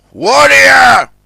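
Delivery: high, loud angry shout; a shout as the barge goes down. A narrator's reading. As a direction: bellow his name after him. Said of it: "he shouted".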